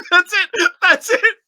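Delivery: high laughing exclamations; cracking up while talking. Real, not acted. Laughing at the short verse.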